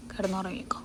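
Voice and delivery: soft whisper to a sleepy pet; whispered lullaby. Real, not acted.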